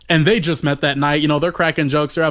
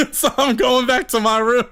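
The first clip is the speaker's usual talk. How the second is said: laughing; 6 semitones higher than usual. Real, not acted. Laughing while telling.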